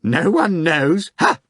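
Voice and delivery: very high mocking cackle of a question; high sneering rotten voice. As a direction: jeer at him from the study. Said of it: "the same rotten voice said".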